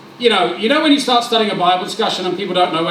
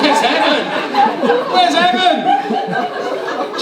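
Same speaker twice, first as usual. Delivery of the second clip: mock indignant; 9 semitones higher, much slower than usual. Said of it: "I'd be like".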